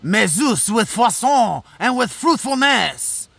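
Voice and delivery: put-on French accent, declaimed. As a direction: recite it with a playful French accent. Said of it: "read that with a French accent".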